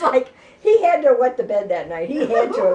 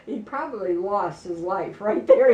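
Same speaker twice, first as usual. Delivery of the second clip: usual speaking voice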